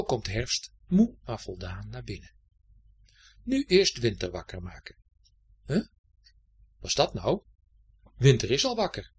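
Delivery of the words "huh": usual speaking voice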